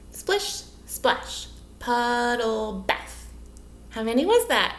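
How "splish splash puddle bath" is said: "Splish, splash, puddle, bath" in separated beats; syllable counting. Shown as a demonstration. Teacher counting syllables with children, "Splish, splash, puddle, bath" beat by beat. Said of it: "Let's count our syllables".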